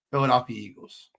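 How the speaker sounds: normal talking voice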